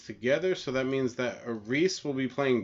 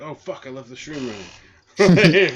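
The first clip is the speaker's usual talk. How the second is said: loud, higher mock panic; retold sudden alarm; 4 semitones higher, 12 dB louder than usual. Streamer retelling his forgotten stream, mock panic. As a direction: act out a sudden panicked realisation, drawn out.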